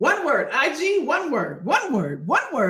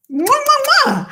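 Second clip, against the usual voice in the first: loud, high kiss smacks; between kisses; 13 semitones higher, 8 dB louder than usual. Real, not acted. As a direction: blow kisses at the camera. Blowing kisses to viewers.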